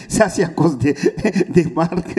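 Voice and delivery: giggling through the line; laughing. Real, not acted. Singer teasing the band about Marc, laughing.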